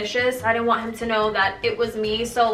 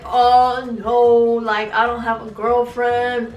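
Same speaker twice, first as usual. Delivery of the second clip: louder mock-boyfriend denial; imitating boyfriend; 5 dB louder, slower than usual. Redoing the cheating boyfriend's denial, mocking imitation. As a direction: put on his drawn-out excuse voice. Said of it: "He would always be like".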